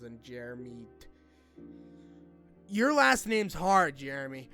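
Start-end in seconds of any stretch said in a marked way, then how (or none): none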